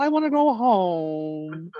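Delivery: put-on slow, lilting little boy's plea; sing-song child voice, stretched out; sing-songy kid voice. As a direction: drag it out like a homesick preschooler. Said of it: "a sing-songy voice".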